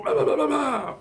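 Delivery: loud, high-pitched blah-blah retort; squealed mock comeback; mock arguing. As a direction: imitate your side of a squabble in high gibberish. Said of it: "giving each other a pissing match".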